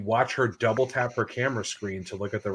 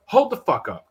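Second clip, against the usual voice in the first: raised, higher irritated outburst; reenacted mad interjection, pitched up and louder; retold anger; 7 semitones higher, 5 dB louder than usual. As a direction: cut someone off angrily, as retold. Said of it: "I'm mad now".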